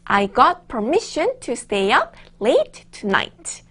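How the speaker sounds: slow, even read-along; slow choral reading. Shown as a demonstration. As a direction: read along slowly with the class. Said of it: "저랑 같이 읽어보도록".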